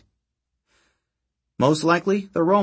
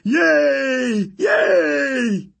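high, loud, joyful cheers; excited yelling; 13 semitones higher, 4 dB louder than usual. Acted. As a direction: yell with childlike glee.

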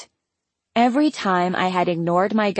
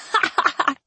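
high-pitched laughing fit; amused character cackle; burst of laughter; 11 semitones higher than usual. A narrator's reading. Karina laughs again.

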